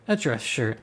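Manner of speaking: ordinary delivery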